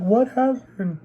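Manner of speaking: high-pitched, imitated sad question; sad concern. Sisters asking burnt brother, acted sad concern. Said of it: "like, all sad".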